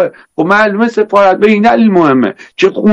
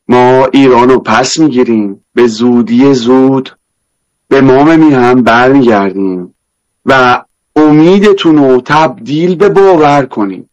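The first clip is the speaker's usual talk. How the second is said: Isfahani accent; 5 semitones lower than usual. Shown as a demonstration. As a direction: lay on a thick Isfahani accent.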